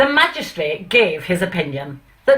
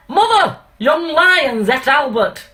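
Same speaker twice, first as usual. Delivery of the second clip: sudden high Mother, then a slow northern lament; a startled northern dad crying out, slowing down; Pa, dismayed; 7 semitones higher, 3 dB louder, slower than usual. Acted. Pa telling Mother the lion ate Albert, northern and aghast. Pa voiced in recited poem.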